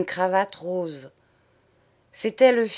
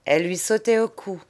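ordinary delivery

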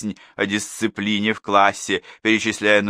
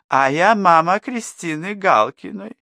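higher, louder woman's voice, slow; chatty mother; 11 semitones higher, 5 dB louder than usual. A narrator's reading. Neighbour introduces herself to Danilov, higher and livelier. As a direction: introduce yourself brightly as a mother.

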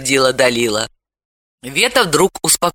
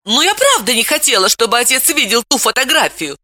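put-on higher girl's voice, fervent and urgent; heated exclamation; 8 semitones higher than usual. A narrator's reading. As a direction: plead it with heat, pitched up. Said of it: "с жаром воскликнула Вета".

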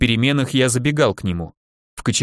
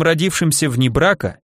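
ordinary delivery, nothing marked

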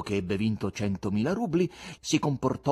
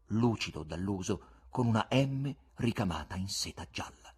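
usual speaking voice

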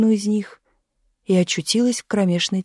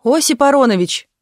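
higher, louder hail by name; calling out; 6 semitones higher, 5 dB louder than usual. A narrator's reading. Watchman hails a lad at the turnstile, calling out. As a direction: suddenly hail someone passing by. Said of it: "вдруг окликнул он".

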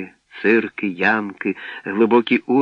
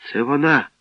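mild, higher-pitched cry of alarm; horrified cry; 5 semitones higher than usual. A narrator's reading. Boy spots his captured friend, mildly horrified. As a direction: exclaim with understated horror. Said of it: "з жахом скрикнув Славко".

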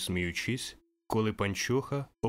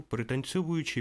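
plain reading voice

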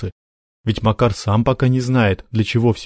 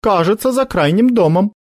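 unsure; 11 semitones higher, 3 dB louder than usual. Acted. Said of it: "неуверенно сказала Соня".

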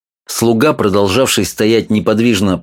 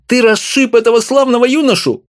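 high, much higher female voice crying out; wife's shrill cry. A narrator's reading. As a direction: cry out at her husband in outrage. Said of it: "закричала она". (жена кричит на мужа высоким голосом)

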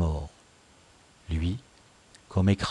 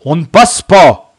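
loud, raised guard's order; growled warning; 7 semitones higher, 15 dB louder than usual. A narrator's reading. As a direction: bar the way with menace. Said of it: "grogna la Crique". (grogner un ordre menaçant)

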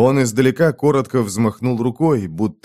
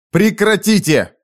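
high, slow loud command; thundering shout; 10 semitones higher, 4 dB louder than usual. Acted. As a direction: roar to stop them. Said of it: "прогремел вдруг ... голос". (громовым голосом)

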